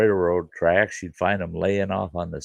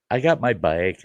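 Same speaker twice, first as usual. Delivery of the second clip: ordinary delivery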